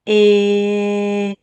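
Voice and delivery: "eh" held long on one steady note; isolated vowel sound. Shown as a demonstration. Teacher names the vowel heard in bell, holding "eh" long. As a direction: stretch out "eh" as a pure vowel.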